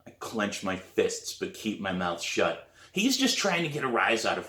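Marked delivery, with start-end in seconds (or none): none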